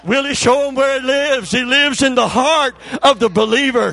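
loud, high, rising preacher's shout; preaching at full force. Acted. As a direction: build it to a roaring climax.

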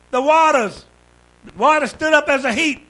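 usual speaking voice

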